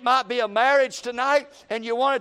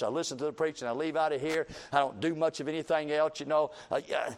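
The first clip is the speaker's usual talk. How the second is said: lower, softer, quick self-pitying complaint; self-pitying regular people; 6 semitones lower, 5 dB quieter than usual. Retold or imitated. Preacher voicing churchgoers who feel like sorry people, mildly mopey and soft. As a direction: rattle off a mild, self-pitying list of failures. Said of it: "That's how they talk".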